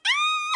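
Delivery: high, loud mock scream; hammed-up shriek breaking into laughter; retold scream. Woman as the roach runs at her, mock screaming. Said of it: "I start screaming".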